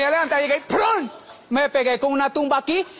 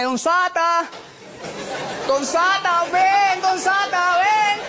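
calling out; 5 semitones higher, much slower than usual. Retold or imitated. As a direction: call him from across the room. Calling out for Satan.

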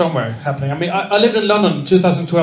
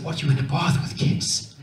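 whispered shocked question, much softer; 5 semitones lower, 8 dB quieter than usual. Retold or imitated. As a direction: hush it like a scandalised Englishman. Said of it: "I said".

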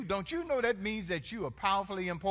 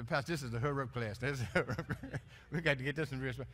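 mocking congregant; 4 semitones lower, much faster than usual. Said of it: "and you say".

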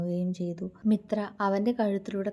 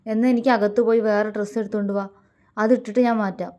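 loud, rapid-fire comeback; heated raised-voice demand; angry retort; 2 semitones higher, 8 dB louder, faster than usual. A narrator's reading. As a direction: fire it back crossly. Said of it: "അവൾ ദേഷ്യത്തോടെ പറഞ്ഞു".

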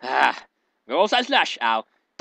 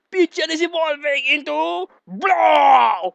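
high, loud hammed-up announcement; mock dramatic shout; 8 semitones higher, 4 dB louder than usual. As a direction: belt it out like a big reveal.

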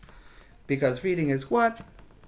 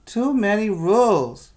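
higher, louder complaint; upset kid; 8 semitones higher, 6 dB louder than usual. Acted. Ben upset with Emma, mildly whining. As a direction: whine it like a frustrated boy. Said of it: "says Ben".

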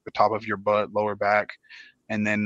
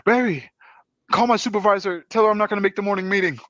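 mock desperate plea; 10 semitones higher, 3 dB louder, faster than usual. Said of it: "I was like".

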